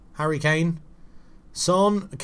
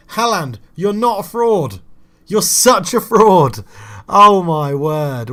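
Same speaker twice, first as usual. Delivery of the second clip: loud, slow, dragged-out insult; exasperated shout; 6 semitones higher, 9 dB louder, slower than usual. Real, not acted. Furious at his striker for missing again, slow loud shout. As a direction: hammer the insult out slowly and loudly. Another missed one-on-one.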